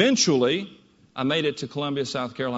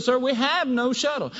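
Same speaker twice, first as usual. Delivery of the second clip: higher, blunt Southern clerk voice; higher Southern woman, matter-of-fact; southern female clerk; 7 semitones higher than usual. Retold or imitated. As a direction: flatly tell him there's no shuttle, Southern style. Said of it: "very southern female voice".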